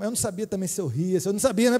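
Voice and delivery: ordinary talk, nothing marked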